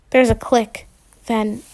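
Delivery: usual speaking voice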